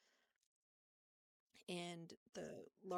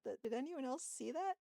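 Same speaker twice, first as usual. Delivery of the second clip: retold disbelief, higher and louder; startled question; 7 semitones higher, 7 dB louder than usual. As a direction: imitate asking round for a witness. Startled, asks if anyone saw.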